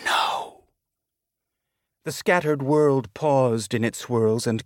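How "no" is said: a vast voice whispering, breathless and unvoiced; whispered no. A narrator's reading. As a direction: breathe the word out like an enormous hush. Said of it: "It whispered".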